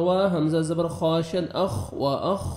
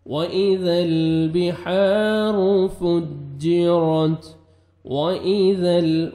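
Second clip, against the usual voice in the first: Quran recitation; 4 dB louder, much slower than usual. Shown as a demonstration. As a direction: chant the verse slowly like a qari.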